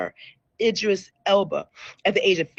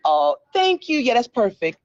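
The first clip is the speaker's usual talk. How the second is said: ordinary talk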